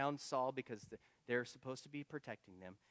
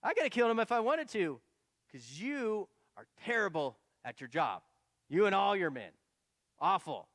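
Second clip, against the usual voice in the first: mocking; 6 semitones higher, 8 dB louder than usual. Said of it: "So David starts to mock Abner".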